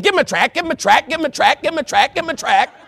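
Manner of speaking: high, loud, insistent chant; nagging inner voice. Acted. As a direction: hammer it out like a nagging thought. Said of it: "everything in the back of my head is saying".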